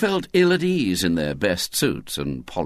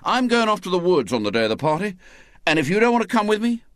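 defiant ultimatum; 7 semitones higher, much faster than usual. Acted. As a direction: rush the dare out at them. William's defiant ultimatum to friends.

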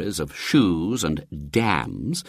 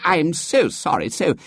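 higher, fussing apologetic curate; fawning vicar; 10 semitones higher than usual. A narrator's reading. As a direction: fall over yourself apologizing. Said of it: "said the vicar".

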